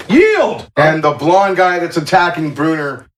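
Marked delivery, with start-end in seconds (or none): 0.1-0.7 high, loud cry of surrender; yelled surrender. A narrator's reading. Game master voices a beaten fighter giving up, yelling. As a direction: yell it out, pitch leaping up. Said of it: "and yells".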